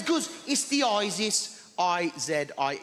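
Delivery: slow, drawn-out Brummie neighbour; a touch of Birmingham accent. Retold or imitated. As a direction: Birmingham old lady saying isis slowly. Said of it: "She's from Birmingham".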